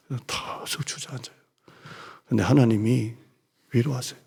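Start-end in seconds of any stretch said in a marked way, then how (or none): 0.1-1.4 breathy whisper, almost no voice. Retold or imitated. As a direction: sink into a spent whisper. Telling of slumping down exhausted.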